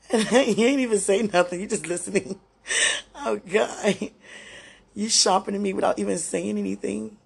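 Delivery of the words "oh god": choked-up cry of relief; tearful. Real, not acted. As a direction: near tears, overcome. Overwhelmed by emotion, crying.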